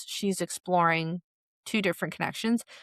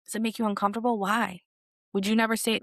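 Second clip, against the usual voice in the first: usual speaking voice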